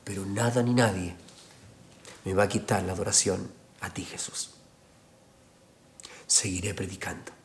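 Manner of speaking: low, soft words fading to a whisper; whispered prayer. Retold or imitated. Kneeling preacher whispers his vow to Jesus. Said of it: "diciéndole".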